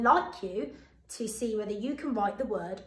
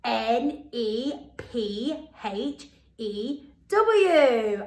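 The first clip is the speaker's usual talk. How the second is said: slow spelling; 5 dB louder, much slower than usual. Shown as a demonstration. Teacher spells the word for children, slowly. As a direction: spell chewing slowly, letter by letter. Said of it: "Let's see if you're right".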